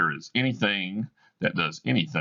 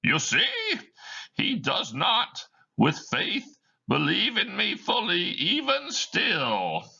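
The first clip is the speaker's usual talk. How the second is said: a ghost talking to the audience; Marley's ghost; 8 semitones higher than usual. Acted. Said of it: "He speaks to the audience".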